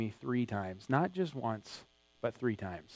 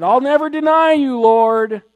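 loud, higher boastful promise; proud vow; 9 semitones higher, 13 dB louder than usual. Retold or imitated. Disciples vowing loyalty to the Lord, proud and loud. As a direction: declare it with proud certainty. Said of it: "They said".

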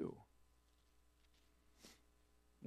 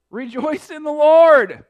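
shouted proclamation; 13 semitones higher, 19 dB louder, slower than usual. Acted. Preacher proclaims the theme.

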